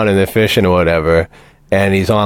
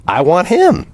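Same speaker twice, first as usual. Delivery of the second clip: higher, demanding brat voice; bratty insistence, pitched up; spoiled kid; 8 semitones higher than usual. Retold or imitated.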